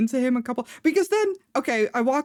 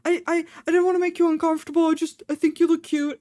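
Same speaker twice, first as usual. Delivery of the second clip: imitating the eager stranger; 6 semitones higher, faster than usual. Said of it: "And he's like".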